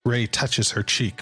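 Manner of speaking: usual speaking voice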